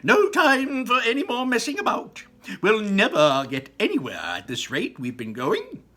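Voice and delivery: loud, high and brisk; impatient Mister Wonka. A narrator's reading. Wonka rushing the group down the corridor, loud and brisk. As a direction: urge everyone to stop dawdling. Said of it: "Mister Wonka was saying".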